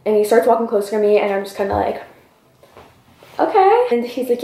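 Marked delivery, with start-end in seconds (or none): 3.4-3.9 high, questioning acted okay; uneasy okay. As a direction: squeak out a wary, rising reply. Uneasy reply as man comes closer.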